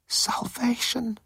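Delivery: slow, hushed whisper; awed whisper. A narrator's reading. Moan finds salvation on the readouts, whispered. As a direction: breathe it out in relief. Moan spots rescue on screen.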